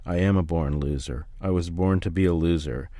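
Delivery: usual speaking voice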